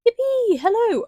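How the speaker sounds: high, bouncy cheer; high cheerful yippee. Real, not acted. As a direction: celebrate like a fan. Cheering being a Kai fan.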